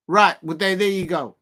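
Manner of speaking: ordinary talk, nothing marked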